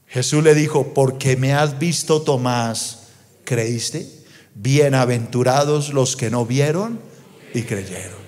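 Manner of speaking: low, hushed close to a reading; solemn scripture reading. Acted. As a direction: recite it low and reverently, softening at the end.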